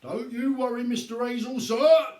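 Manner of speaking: policeman calling out. A narrator's reading. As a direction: call out to reassure the gentleman. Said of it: "Sergeant Samways cried out".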